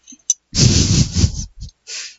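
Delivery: loud, breathy burst of laughter. Real, not acted. Listener amused by a show full of drama, laughing. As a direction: laugh out loud without words.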